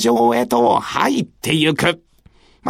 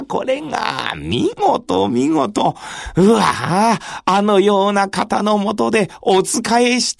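gasping in admiration; wide-eyed young retainer; awed young samurai; 2 semitones higher than usual. Acted.

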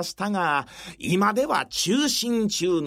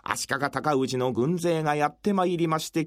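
usual speaking voice

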